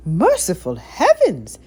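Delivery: be shocked at the rat; loud stunned exclamation. A narrator's reading. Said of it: "exclaimed Aunt Sarah".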